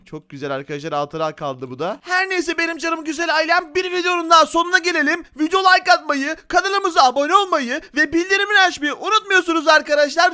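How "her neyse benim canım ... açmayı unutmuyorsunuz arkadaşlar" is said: high, loud, energetic sign-off; rally the subscribers at full volume; hyped channel plug; vlogger outro. Real, not acted.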